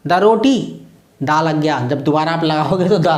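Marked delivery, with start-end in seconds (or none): none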